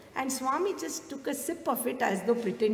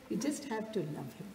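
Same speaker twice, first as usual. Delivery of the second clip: much softer, intimate repeat; softer echo; 2 semitones lower, 10 dB quieter than usual. Shown as a demonstration. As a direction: let it sink in quietly. Repeated for emphasis.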